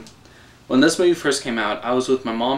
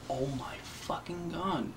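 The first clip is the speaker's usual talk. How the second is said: awed hush; 17 dB quieter than usual. Retold or imitated. Awed audience in the theater.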